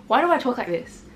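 ordinary talk, nothing marked

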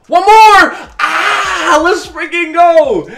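high, loud victory yell; ecstatic scream. Real, not acted. Gamer screaming as his runner scores. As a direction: scream it out, drawn out at the end.

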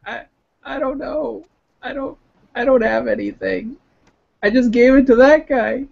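put-on high, crying old woman voice; tearful old woman. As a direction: voice her high and on the verge of tears. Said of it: "she starts to cry a little bit".

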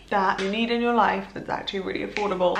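ordinary talk

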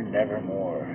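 usual speaking voice

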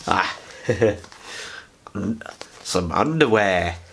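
ordinary talk, nothing marked

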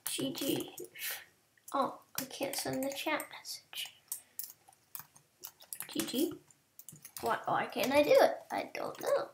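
hushed unvoiced whisper throughout; soft whispered muttering to self. Real, not acted. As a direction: whisper it like someone's asleep nearby. Whispering through chat fix.

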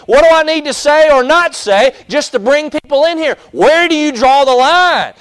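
loud, high, driving preacher's challenge; preaching at full force. Acted. Preacher challenging the congregation, loud and high. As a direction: pound the congregation with questions.

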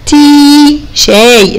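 "تي" with a long ee; long vowel. Shown as a demonstration. Teacher showing the long vowel to children. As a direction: stretch the "تي". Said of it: "هنطق الأولاني طويل".

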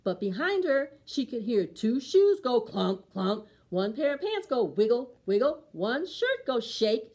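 ordinary delivery, nothing marked

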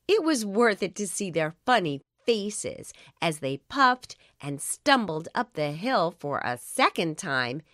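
plain reading voice

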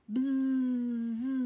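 high buzzing hum like a bee. Acted. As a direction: buzz it out like a bee.

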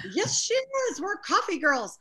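proudly insist, delighted; proud cheerful reply. Retold or imitated. Daughter answers the surprised nurses.

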